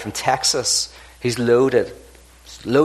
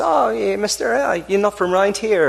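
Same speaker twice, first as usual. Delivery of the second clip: higher-pitched Irish country farmer; a lilting Irish farmer sizing up a stranger; 7 semitones higher than usual. Acted. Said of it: "pulls up and says".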